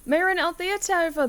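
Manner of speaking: usual speaking voice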